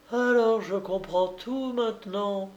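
high, composed declaration; calm realisation; young woman's voice. Acted. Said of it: "déclarait la jeune femme". (voix haute de jeune femme)

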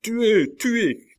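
hurlé très haut et très fort; cri. A narrator's reading. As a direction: hurler à la meute de tuer. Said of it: "crièrent les assassins". (les assassins hurlent après la victime)

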